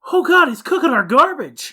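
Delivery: hammed-up kid complaint; disgusted kid. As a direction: squeal it like a grossed-out boy. Imitating disgusted Miles, laughing.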